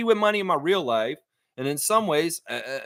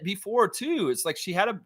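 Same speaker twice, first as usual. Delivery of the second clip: normal talking voice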